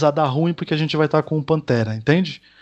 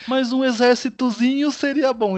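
high, slow, offhand request for a little army; sly casual ask; 10 semitones higher than usual. Retold or imitated. Mbaku casually asking T'Challa for an army, sly high voice. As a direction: toss it off like it's nothing, voice pitched up. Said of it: "do jeito como ele fala".

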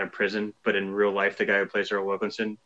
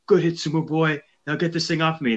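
mock football player; 6 semitones higher than usual. Quoting a movie player's line.

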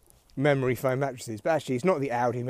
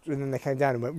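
usual speaking voice

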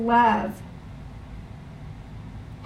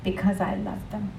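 softer, lower, tender reply; God's gentle answer; 3 semitones lower, 6 dB quieter than usual. Retold or imitated. Preacher voicing God's answer to him, hushed and tender. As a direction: say it hushed, as God spoke it. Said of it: "This was his answer to me".

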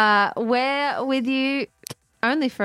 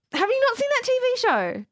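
normal talking voice

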